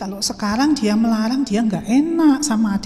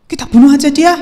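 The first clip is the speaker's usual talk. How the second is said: sharp loud burst, raised pitch; murderous urging; 5 semitones higher, 11 dB louder, faster than usual. Acted. Brother to his siblings about Yusuf, murderous urging. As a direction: urge it on loudly. Brother urges killing Joseph.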